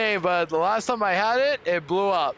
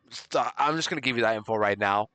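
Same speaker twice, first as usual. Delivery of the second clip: ordinary talk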